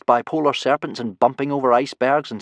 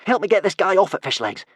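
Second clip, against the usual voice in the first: high, loud call for help; raised, pressing voice dropping at the end; urgent call; 8 semitones higher, 4 dB louder than usual. A narrator's reading. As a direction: call urgently to a friend for a hand. Urgent plea for help in crisis.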